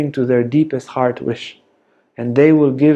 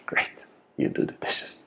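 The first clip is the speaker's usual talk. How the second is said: gleeful whispered aside, with a laugh; sneaky whisper; 15 dB quieter than usual. Acted. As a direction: whisper it like a secret win behind their back. Partner gloating aside about dishes.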